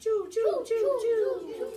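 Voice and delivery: high, loud choo choo; train whistle. Shown as a demonstration. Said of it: "hear some expression".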